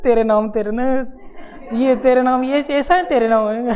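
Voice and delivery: loud, laughing mock of the hero; mocking laughter. Courtiers mock the arriving man, laughing loudly. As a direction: jeer through laughter at his face.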